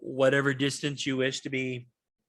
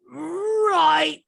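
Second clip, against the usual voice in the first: high, louder, hurried; goblin voice; 10 semitones higher, 5 dB louder, slower than usual. Acted. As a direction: call down that she's coming, flustered. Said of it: "she goes".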